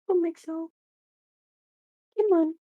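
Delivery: coaxing the cat onto the lap. Real, not acted. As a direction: call the cat over sweetly.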